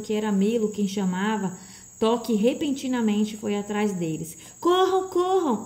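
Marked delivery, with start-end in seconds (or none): 4.6-5.6 high, slow, insistent call; insistent cry. A narrator's reading. The voice insists they run, calling urgently. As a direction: urge them on, high and drawn out. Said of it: "insistiu a voz".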